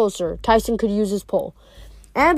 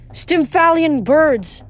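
high, drawn-out cry; yelled warning; 6 semitones higher, 3 dB louder, much slower than usual. A narrator's reading. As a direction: call the warning slowly, pitched up. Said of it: "Annabeth yelled".

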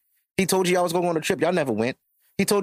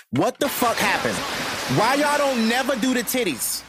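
incredulous rant; 7 semitones higher than usual. Real, not acted. Ranting about women, pitch high.

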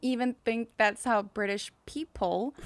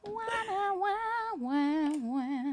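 mock crying wah; 9 semitones higher, 8 dB quieter, slower than usual. Mock crying after feeling bad.

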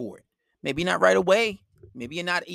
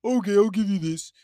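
mock eager boyfriend; 2 semitones higher, 3 dB louder than usual. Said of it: "if you pander to her and say".

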